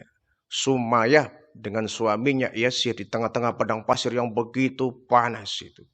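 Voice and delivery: normal talking voice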